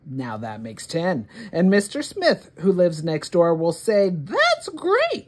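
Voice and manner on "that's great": neighbour's praise. Acted.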